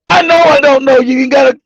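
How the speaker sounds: ordinary talk, nothing marked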